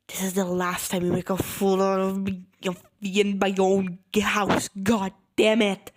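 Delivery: loud, mounting mock fury; hammed-up furious telling-off; angry scolding. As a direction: build to a loud exasperated curse.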